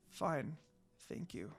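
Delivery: fading, worn-out murmur; a soft, tired thank you; tired and faint. A narrator's reading. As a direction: barely get the words out, exhausted. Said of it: "She smiled, but tiredly".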